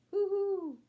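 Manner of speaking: high, hooting owl call. Acted. An owl calling out at night, high hoot.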